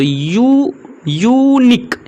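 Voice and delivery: teacherly drawn-out "yoo-nik" for "यूनिक"; pronunciation demonstration. Shown as a demonstration. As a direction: draw out "यू", then say "यूनिक" in syllables. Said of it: "यू से उच्चारण हो रहा है".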